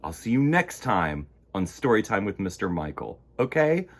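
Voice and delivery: ordinary delivery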